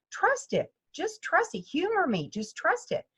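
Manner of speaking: high, gentle reassurance; coaxing. Retold or imitated. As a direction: coax a doubtful student to trust. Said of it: "And I said".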